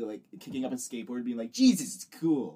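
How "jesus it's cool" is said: slow, loud cartoon skater kid; drawn-out skater kid. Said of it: "like a kid skateboarding".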